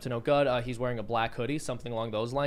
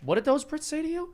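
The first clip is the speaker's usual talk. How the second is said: mock suspicion; 12 semitones higher than usual. Mock grilling over Irish name.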